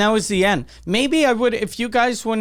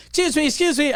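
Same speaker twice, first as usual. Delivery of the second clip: higher mock waitress voice; waitress calling; 9 semitones higher, faster than usual. A waitress stopping him at the door, higher mock voice. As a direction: call after him like a waitress. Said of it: "a waitress stops and she goes".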